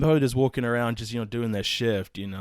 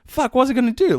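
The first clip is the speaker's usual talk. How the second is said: much higher, louder outburst; retold exasperation; 8 dB louder than usual. Guard retells defending himself, exasperated outburst. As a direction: burst out defensively. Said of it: "And I'm like".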